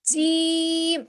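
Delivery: جیم stretched long and loud; drawn-out jeem. Shown as a demonstration. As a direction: pull out the sound of جیم. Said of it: "جیم کو کھینچ کے پڑھنا ہے".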